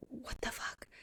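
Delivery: voiceless, barely audible disbelief; hushed horrified aside; stunned whisper. Acted. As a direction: whisper it in shock. Character's stunned reaction to forced death.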